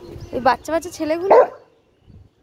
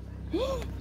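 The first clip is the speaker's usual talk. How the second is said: a quick, high startled gasp; sharp gasp; 9 semitones higher, 10 dB quieter than usual. Real, not acted. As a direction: catch your breath in sudden alarm. Sudden alarm on the walk. (চমকে হঠাৎ হাঁপিয়ে ওঠা)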